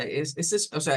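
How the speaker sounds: normal talking voice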